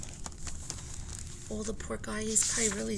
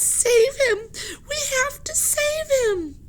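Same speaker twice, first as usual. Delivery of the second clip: very high, loud crying plea; tearful plea; 5 dB louder than usual. Acted. Annie in tears begs Jack, very high. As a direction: beg him through tears.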